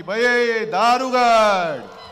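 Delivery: slow drawn-out call to the goddess; ritual invocation. Acted. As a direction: cry out to the goddess like a devotee. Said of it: "तिला मी आज साथ घालतोय की".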